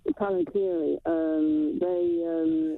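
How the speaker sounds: muffled, as if through a hand; words blocked by a covered mic. Real, not acted. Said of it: "I think you're muffled".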